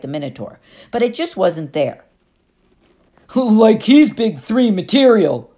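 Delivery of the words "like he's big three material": loud, slow, husky mockery; mocking bully. Clarice mocks Percy while pushing him, loud husky scoff. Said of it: "Clarice said".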